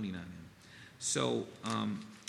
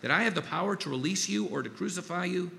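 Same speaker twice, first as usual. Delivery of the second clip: higher, louder demand from Pilate; 7 semitones higher, 5 dB louder than usual. Acted. Pilate demanding an answer from Jesus, a raised demanding voice. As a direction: press the prisoner hard, voice rising. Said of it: "Pilate demanded".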